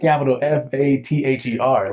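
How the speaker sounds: "F A T H E R" spelled out high and loud; "capital F A T H E R" proclaimed letter by letter; emphatic spelling. Real, not acted. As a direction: punch each letter of "F A T H E R". Spelling out for emphasis, others laugh.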